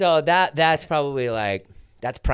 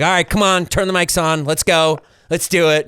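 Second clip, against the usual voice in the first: loud, eager, rallying call; pumped host voice; 8 semitones higher, 11 dB louder than usual. Retold or imitated. Imitating David rallying his partner, loud and eager. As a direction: hype up the podcast like a coach. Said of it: "He'd be like".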